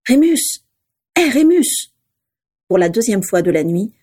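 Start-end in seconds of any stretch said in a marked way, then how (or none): 0.1-0.6 higher call to wake him. Acted. Waking someone, calling his name. 1.2-1.9 higher, insistent call. Acted. Waking someone, calling his name.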